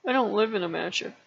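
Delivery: a light touch of moping; mock depressed. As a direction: sigh it out like you've given up. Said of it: "You can choose to be depressed".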